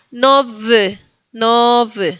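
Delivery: "no v" ending in a vowel close to E; Y near E. Shown as a demonstration. Teacher repeats second example, "no v" ending near E. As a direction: buzz the V in "no v", then an E-like vowel. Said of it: "La Y se pronuncia parecida a una E".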